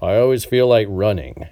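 a light take on his delivery. Retold or imitated. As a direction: mimic the poet's phrasing, half-sung. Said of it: "he sounds much better when he says it".

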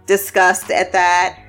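ordinary delivery

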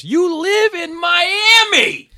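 imitated yell, very high and drawn out. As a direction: wail it as a stretched mock shout.

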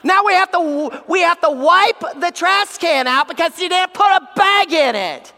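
high, loud exasperated wife; scolding wife. Acted. Wife to husband who forgot the trash bag, scolding. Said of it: "our wife comes back in and says".

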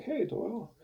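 retold greeting. Retold friend's greeting back.

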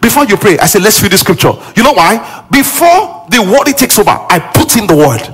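high-pitched, driving sermon voice; impassioned preaching. Real, not acted. Preacher teaching how to pray, impassioned and high. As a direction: drive each phrase home, high and forceful.

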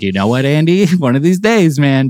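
high playful mock threat, half laughing. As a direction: tease Andy with a joking warning. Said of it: "sometimes I'm like".

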